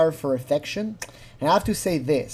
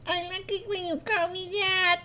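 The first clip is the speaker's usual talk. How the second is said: much higher, softer, put-on girly sweetness; forced girly; 6 dB quieter than usual. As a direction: coo it high like a nervous young girl. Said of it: "You can feel that it's a bit forced".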